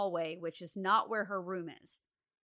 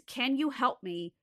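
normal talking voice

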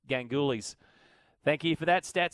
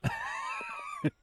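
burst of laughter; 5 dB quieter than usual. Real, not acted. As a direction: let out a big, sudden laugh. Host cracks up at caller's joke.

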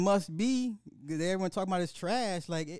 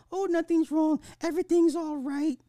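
mock girlfriend voice; 11 semitones higher, 6 dB louder than usual. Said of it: "He's like".

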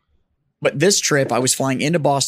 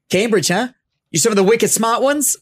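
high, louder imitation of a Boston cab driver's accent; mock Boston cabbie accent, raised pitch; 8 semitones higher, 4 dB louder than usual. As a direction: ham up a local Boston cabbie teasing. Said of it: "but we got a local".

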